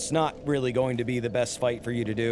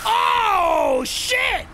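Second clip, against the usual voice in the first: much higher, loud startled yell; real shocked shout; 6 dB louder than usual. Real, not acted. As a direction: blurt it as the fight flips. Close match ending.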